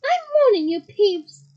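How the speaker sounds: loud, high warning; warning shout. Acted. McGonagall shouting her warning at Peeves. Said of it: "shouted Professor McGonagall".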